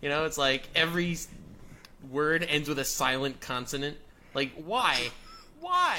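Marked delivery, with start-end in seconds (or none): 5.6-6.0 exasperated high why. Real, not acted. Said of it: "French silent letters rant".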